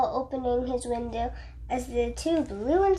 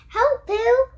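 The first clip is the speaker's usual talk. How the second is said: high, loud cry for help; small Piglet crying help; Piglet calling for help; 9 semitones higher, 4 dB louder than usual. A narrator's reading. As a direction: squeak out to Pooh as the wind lifts you. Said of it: "Piglet called".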